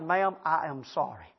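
slow, low, softened apology; contrite apology. Retold or imitated. Customer to drive-through clerk after snapping, contrite. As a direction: drop low and soft, word by word.

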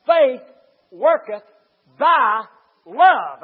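faith, worketh, by, love each punched loud and apart; word by word emphasis. Shown as a demonstration. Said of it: "according to Galatians five six".

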